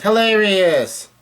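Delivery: high, slow, drawn-out exclamation; mock exclamation. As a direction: stretch it out high, acting the character. Voicing a character's retort.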